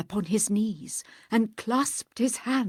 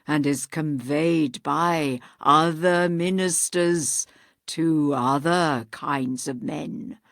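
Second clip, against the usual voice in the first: hollow ghost; 7 dB louder than usual. Acted. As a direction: intone like a dead man's spirit. Voices ghost.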